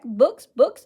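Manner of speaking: usual speaking voice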